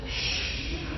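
long, soft shushing hiss; imitated tinnitus hiss. Shown as a demonstration. Sufferer demonstrating the noise in his ears, hissing.

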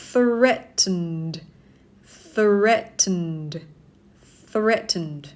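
"threatened" as THRET-nd, vowelless ending; two-syllable pronunciation. Shown as a demonstration. Teacher models it for learners, slow "threatened" as THRET-nd. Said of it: "ออกเสียงสองพยางค์นะคะ".

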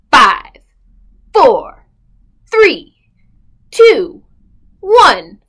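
loud countdown, each number punched; called countdown. Acted. Storyteller counts down loudly for the children. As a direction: call out the count like a launch.

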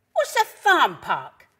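loud, slow, puzzled dog character; Alfie. A narrator's reading. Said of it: "barked Alfie".

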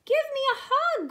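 high, loud plea; crying out. Acted. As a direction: call out as an eager little bear. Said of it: "cried Douglas".

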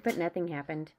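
plain reading voice

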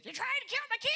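high shrill-free mock screech of an angry mom; high, loud imitation of an angry woman; angry mother. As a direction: squeal it as the furious mother.

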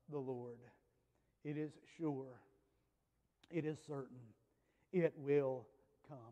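hushed, low and weighty; short phrases dropped softly with long pauses; quiet, grave certainty, let each statement settle; solemn assurance. Real, not acted. Preacher solemnly drives certainty home.

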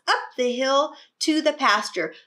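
ordinary delivery, nothing marked

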